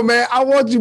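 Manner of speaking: normal talking voice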